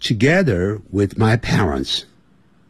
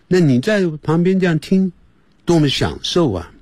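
usual speaking voice

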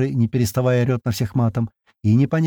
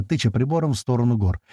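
usual speaking voice

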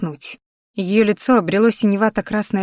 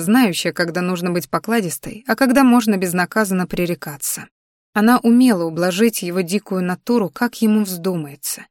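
ordinary delivery

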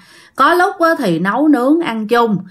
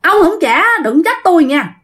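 higher-pitched retold angry warning; very annoyed; 6 semitones higher than usual. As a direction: warn him, acting out rising irritation. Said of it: "anh Minh mới tức quá".

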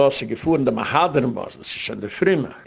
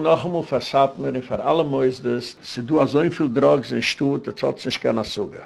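ordinary talk, nothing marked

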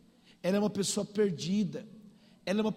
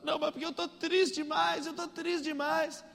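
tearful; 7 semitones higher than usual. Retold or imitated. Weeping girl confides in a stranger, high mock-tearful. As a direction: whine it as a weeping girl would. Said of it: "falou chorando comigo".

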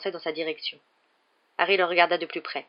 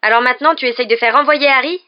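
loud, high, furious exclamation; outraged, much louder and higher; 7 semitones higher, 11 dB louder than usual. A narrator's reading. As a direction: explode in angry accusation. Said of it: "s'exclama Ron avec fureur".

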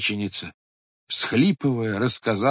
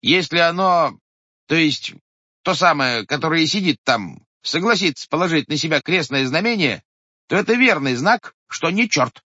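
raised, earnest clerk explaining the test; clerk reasoning; 8 semitones higher, 5 dB louder than usual. A narrator's reading. Clerk explaining the cross test to villagers, raised voice. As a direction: lay out the sign step by step. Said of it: "сказал писарь".

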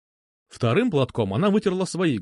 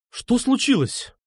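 high-pitched, drawn-out alarmed question; startled exclamation; 11 semitones higher, slower than usual. A narrator's reading. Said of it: "воскликнул я".

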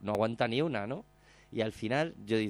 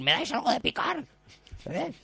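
much higher put-on voice; a cheeky extra begging for snacks; mock request; 12 semitones higher than usual. Bikers asking in the kitchen. (petición burlona con voz aguda)

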